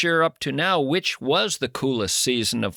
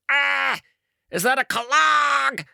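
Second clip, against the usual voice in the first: disgusted customer; 7 semitones higher, slower than usual. Acted. Acted shoe store customer.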